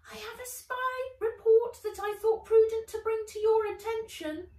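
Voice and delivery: high, far-off little robot voice; a tiny robot beetle reporting. Acted. Beetlebot reporting to Ivy, high pitched. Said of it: "it said".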